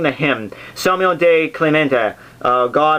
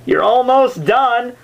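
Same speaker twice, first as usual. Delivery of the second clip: higher, slow, sing-song mock reassurance; lightly acted guide cheerfully understating the danger; Virgil coaxing; 8 semitones higher, slower than usual. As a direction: play Virgil gently coaxing Dante on. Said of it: "and he's like".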